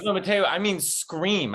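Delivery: slow, stressed "scream" to insist. Retold or imitated. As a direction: lean slowly on the word "scream". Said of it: "He goes".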